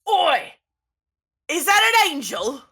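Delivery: high, loud heckler's shout; stage singer yelling. Acted. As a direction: holler at intruders from the stage. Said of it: "I don't know why I sound like this".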